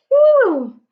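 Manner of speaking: big loud exhale of relief; relieved phew. Acted. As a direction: blow it out hard, high and loud. Relief after holding breath.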